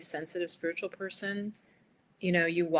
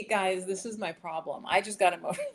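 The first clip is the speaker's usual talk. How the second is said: usual speaking voice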